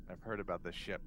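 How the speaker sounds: usual speaking voice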